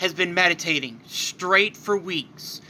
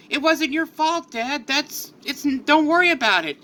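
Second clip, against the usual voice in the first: put-on young Gohan; 8 semitones higher than usual. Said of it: "Gohan answers his dad".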